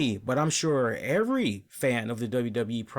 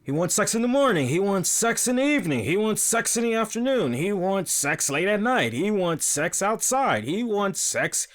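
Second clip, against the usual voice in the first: mocking rant; 4 semitones higher than usual. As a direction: pile it on with rising mockery. Mocking Rusev's comedic storyline.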